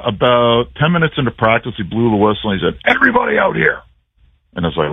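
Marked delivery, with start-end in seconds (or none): 2.8-3.8 retold coach's call, pitched much higher; sharp mock coach call across the field. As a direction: bark it like a coach summoning the team. Coach calls team to midfield.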